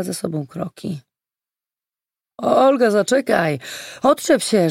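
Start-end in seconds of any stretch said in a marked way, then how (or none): 2.4-3.6 raised, higher, louder call; calling after her. A narrator's reading. Man calls after Olga in the dark street, urgent call. 4.0-4.7 higher, louder brush-off; thrown over the shoulder. A narrator's reading. Olga shakes off the man following her, irritated retort. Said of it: "rzuciła przez ramię".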